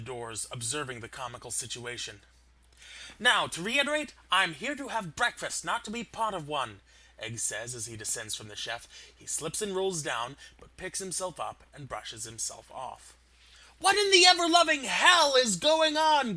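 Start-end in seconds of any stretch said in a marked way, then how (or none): 3.2-6.8 huffy egg voice. Acted. Egg scolds huffily. 13.8-16.4 much higher, much louder demand; furious shout. Acted. Gaiman bursts in armed.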